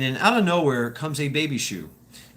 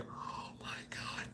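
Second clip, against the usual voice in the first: slow, breathy whispered sigh of despair; whispered exasperation; 22 dB quieter, slower than usual. Retold or imitated. Tired mother whispering about her kids, exasperated whisper. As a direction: whisper it like a worn-out mom. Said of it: "she's just like".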